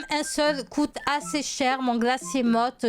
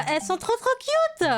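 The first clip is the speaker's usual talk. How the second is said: much higher, squealy trop cute; gushing; 10 semitones higher than usual. Real, not acted. YouTuber squealing at her new pets, much higher. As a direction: squeal with delight. Gushing over Christmas ornaments.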